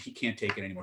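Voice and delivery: ordinary talk, nothing marked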